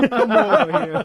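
hammed-up Italian lounge lizard, high and loud; slow, leering Italian come-on; mock Italian accent. Friend mocking a governor's pickup line in Italian accent. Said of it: "he has a horrible Italian accent".

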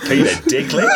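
high, lightly put-on Australian accent; a hint of Australian, squeaked out high. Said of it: "That's not a bad accent".

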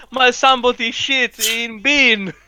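slow, high put-on voice; cleaner telling husband. Imagined cleaner reporting to her husband, slow high mock voice. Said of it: "Goes home and tells, like, her, her husband".

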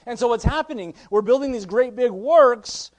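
building sermon fervor; impassioned preaching. Real, not acted. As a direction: preach it hard, stretching the key words. Preaches at full force.

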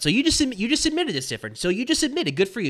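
high, fast gloating gotcha. Real, not acted. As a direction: pounce on the admission. Gloating at Phil.

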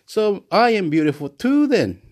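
usual speaking voice

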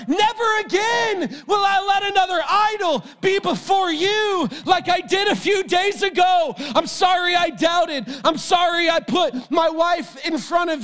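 high, shouted, pleading vow; impassioned repentance. Acted. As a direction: cry it out with remorse. Peter repenting on the shore.

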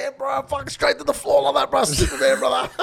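high, loud, laughing boast; hammed-up brag. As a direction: sell the lie to the boys, grinning. Retold bragging to the boys.

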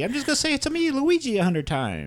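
very high cartoon Italian; Luigi. Luigi answering his questioner, high squeaky Italian voice. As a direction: answer in a helpless falsetto character voice. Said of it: "Oh, Luigi, why you got to talk".

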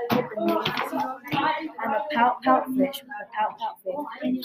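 drawn out slowly in a strange voice; slow gloomy refrain. Acted. Fish moans his refrain to the octopus, slowly drawn out. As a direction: stretch every pout out, very slowly.

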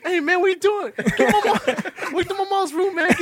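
high, loud, laughing mock anger; mock his angry friend, laughing; mad friend. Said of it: "He's so mad".

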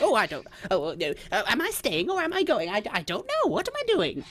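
put-on high-pitched, dithering old dear; confused old lady. Old lady unsure if she's staying or going, confused and high. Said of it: "like, confused and like".

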